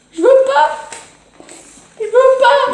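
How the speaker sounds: very high, scared squeal; real panicky, high-pitched refusal. Real, not acted. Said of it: "C'est quoi cette voix de fillette".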